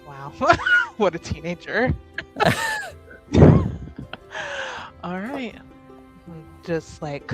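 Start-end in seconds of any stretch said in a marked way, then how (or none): 0.4-0.9 high burst of laughter; amused laugh. Real, not acted. Player laughs at the teenage character, amused high laugh. 2.0-5.0 long high laughter; amused giggling. Real, not acted. Player tickled by what a teenager she is, long amused laugh.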